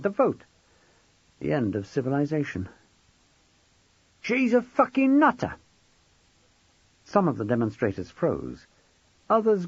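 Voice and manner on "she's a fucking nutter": louder, higher blurt of the swear; an outburst louder than the first go. Acted. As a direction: let it burst out, shocking the room.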